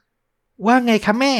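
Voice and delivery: put-on high, slow sweet child voice; a smiling daughter greets her mom; cheerful little girl. A narrator's reading. Daughter greeting mother with a smile, high and sweet. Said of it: "เลล่าพูดพร้อมรอยยิ้ม".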